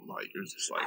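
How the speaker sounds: ordinary delivery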